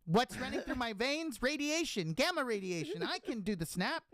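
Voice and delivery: high, whiny hero lines; high mock Edward Norton. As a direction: parody Edward Norton's Hulk. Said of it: "I don't wanna hear him be like".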